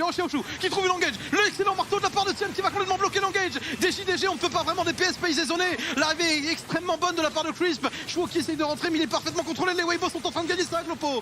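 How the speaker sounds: high excited fight call; esports caster. Acted. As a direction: pitch up and race the action.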